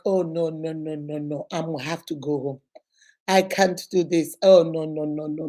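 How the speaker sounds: low lament, repeating the no's; mock-weepy, low-pitched refusal; re-enacted tearful despair. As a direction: moan it like a mother giving up. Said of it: "and just started crying".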